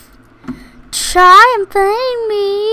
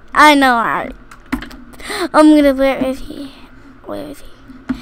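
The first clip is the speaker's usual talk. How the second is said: ordinary delivery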